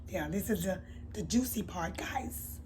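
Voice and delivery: breathy secretive whisper to the camera. Real, not acted. Vlogger teasing viewers about the juicy part, whispered. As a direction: lean in and whisper it like gossip.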